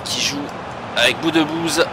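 live match commentary, building energy; play-by-play commentary. Acted. Live commentary on his own match.

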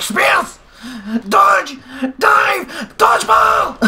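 high excited shouts; excited yelling. Real, not acted. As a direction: call out like a frantic announcer. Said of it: "Making your own sound effects".

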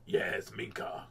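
breathy whispered name. Retold or imitated. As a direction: whisper it close behind someone. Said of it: "I went".

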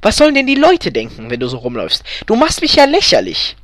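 high, loud, indignant wife; scolding. A narrator's reading. Wife scolding husband in story.

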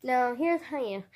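ordinary talk

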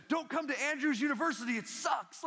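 high-pitched, over-urgent stranger's warning; hammed-up orientation spoiler; mock warning. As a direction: blurt it out high like an alarmed stranger. Acts out a stranger warning freshmen.

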